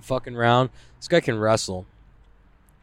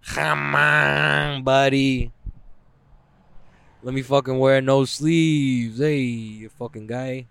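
a lightly voiced, slow bro-ish plea; mock striker; slower than usual. Imitating strikers begging to wear no sleeves, slow bro voice. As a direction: drag it out like a lunkhead striker. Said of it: "The strikers".

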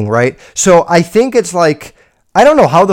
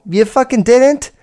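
incredulous; 9 semitones higher than usual. Real, not acted. Mocking the girl's excuse.

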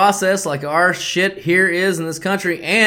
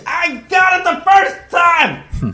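shout; 12 semitones higher, slower than usual. Real, not acted. Shouted correction.

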